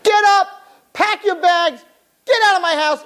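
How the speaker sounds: high, loud furious shriek; angry wife yelling. Acted. Wife yelling at her drunk husband, shrill and furious. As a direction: scream him out of bed.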